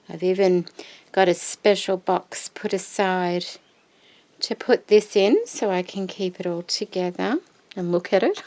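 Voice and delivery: ordinary talk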